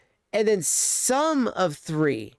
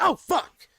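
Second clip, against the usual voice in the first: startled yelp; 8 semitones higher than usual. Retold or imitated. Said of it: "You're like".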